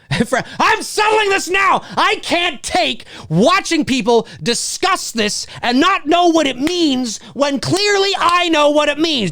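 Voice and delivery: an outraged know-it-all settling the debate; mock indignant viewer. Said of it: "It's kinda what happened there".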